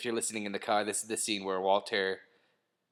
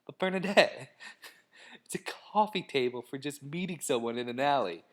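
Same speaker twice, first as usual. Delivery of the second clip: mock pleading; 5 semitones higher than usual. Husband mock-arguing for a Craigslist coffee table, high pleading. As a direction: plead in a put-on higher voice. Acted argument with cautious partner.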